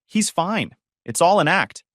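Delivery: high-pitched, insistent dismissal; dismissive insistence. A narrator's reading. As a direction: wave it away emphatically. Sister dismisses injury to room.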